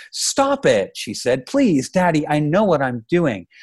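high-pitched, upset daughter's appeal; exasperated grown daughter begging off; pleading protest. Acted. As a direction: plead with Dad to stop, pitch lifted. Daughter pleads with her father.

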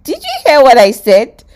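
loud, high, incredulous question; exasperated teacher. Acted. Phoebe checking that Joey listened, loudly exasperated. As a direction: demand it of a hopeless pupil.

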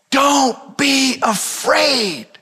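very slow, drawn-out calm; slow reassurance. Acted. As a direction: stretch each word to settle them. Repeating Jesus' words.

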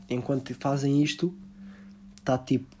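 ordinary delivery

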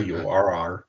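slow, drawn-out Bristol burr; Bristol accent. Shown as a demonstration. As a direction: stretch out the Bristol R sound. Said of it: "Well, you could do Bristol".

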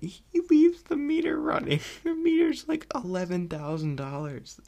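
laughing fit over the meter joke. Real, not acted. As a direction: squeal the words while cracking up. Cracking up at taxi gag.

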